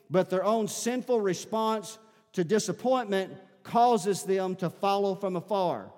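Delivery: loud, high, driving sermon delivery; high-pitched pulpit proclamation at full volume; full-force preaching. Acted. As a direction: preach it out hard to the whole congregation.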